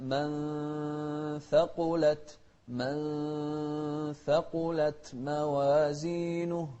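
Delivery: من ثقلت slow, with a held nasal nun; slow ikhfa. Shown as a demonstration. Teacher slowly shows ikhfa in من ثقلت. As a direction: stretch the hidden nun in من ثقلت. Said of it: "فنوضح المقدار ونوضح الإخفاء".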